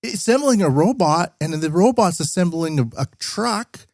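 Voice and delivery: high-pitched mocking whine of naive worry; exaggerated high voice of a clueless worrier; mock Western hand-wringer. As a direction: fret in a silly high voice, slowing down towards the end. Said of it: "That's what so many people in the West".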